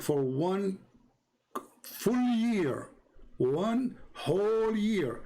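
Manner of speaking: "one", "full year", "one whole year" drawn out high and slow; emphatic stress. Real, not acted. Debater reads out disputed question to opponent, emphatic stress on "whole year". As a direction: stretch "full year" and "whole year", pitched well up.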